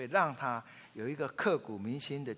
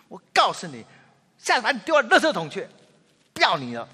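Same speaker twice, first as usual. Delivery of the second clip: loud, higher threatening yell; mock a parent's overblown threat; preacher mimics a parent threatening the child, loud; harsh scolding; 6 semitones higher, 11 dB louder than usual. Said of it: "再狠狠地骂几句".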